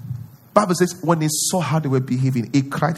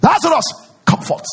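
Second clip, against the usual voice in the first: high, loud command; Jesus commanding Lazarus, loud and high; commanding shout; 12 semitones higher, 6 dB louder than usual. Retold or imitated. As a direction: call him out with authority. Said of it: "The one I prayed is done".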